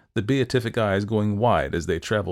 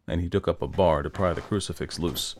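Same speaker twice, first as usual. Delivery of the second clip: usual speaking voice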